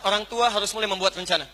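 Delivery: ordinary talk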